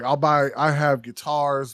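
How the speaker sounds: ordinary delivery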